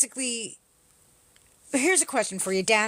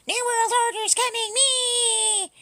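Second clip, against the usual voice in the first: high, wailing mock panic; hammed-up doomsday wail, much higher; mock conspiracy scream; 11 semitones higher, slower than usual. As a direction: squeal it like a panicked conspiracy nut. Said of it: "You guys were all screaming about".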